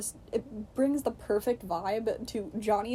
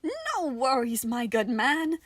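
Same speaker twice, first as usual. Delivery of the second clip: jolly Nicola voice; 4 semitones higher, 5 dB louder, slower than usual. A narrator's reading. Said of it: "Nicola called".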